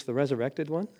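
ordinary talk, nothing marked

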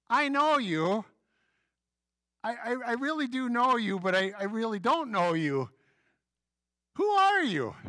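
high, loud puzzled pleading; flustered. Retold or imitated. Pastor confronting a stranger he can't place, high and flustered. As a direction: blurt it out, embarrassed and confused. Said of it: "I said".

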